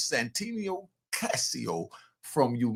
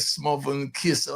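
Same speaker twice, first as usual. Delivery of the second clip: usual speaking voice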